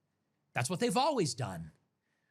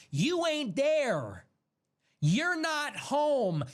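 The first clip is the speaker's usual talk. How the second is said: raised slow scorn; 9 semitones higher, 3 dB louder, much slower than usual. Real, not acted. Angry address to opponents.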